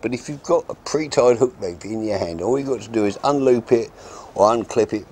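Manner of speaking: ordinary talk, nothing marked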